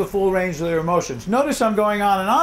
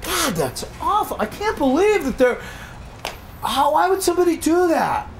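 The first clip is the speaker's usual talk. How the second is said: high-pitched, incredulous dismay; aghast and disbelieving, voice lifted high; appalled reaction; 8 semitones higher than usual. Acted. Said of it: "read something in the newspaper and I go".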